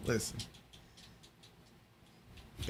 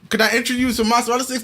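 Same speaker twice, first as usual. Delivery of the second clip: pushy waiter; 7 semitones higher, faster than usual. Retold or imitated. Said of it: "you going in".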